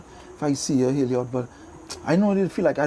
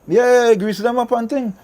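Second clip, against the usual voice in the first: ordinary talk, nothing marked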